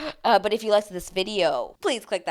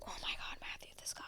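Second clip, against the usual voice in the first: unvoiced exasperated whisper; 18 dB quieter than usual. Real, not acted. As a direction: hiss it in disbelief under your breath. Exasperated aside about him.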